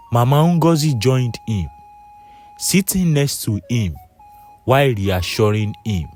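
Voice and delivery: ordinary delivery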